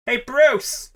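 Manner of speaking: high, carrying call; casual high-pitched holler; calling across the street. Acted. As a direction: hail someone from over the road. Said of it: "someone else is like".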